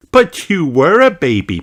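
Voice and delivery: lightly voiced gentle mother; mother answering her daughter; Mummy Pig's voice. A narrator's reading. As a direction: read as a patient mother. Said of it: "said Mummy Pig".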